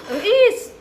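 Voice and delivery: sudden high startled "इस"; a quick alarmed cry as the plate tips; startled gasp. Real, not acted. Woman sees the crow tipping the plate, startled.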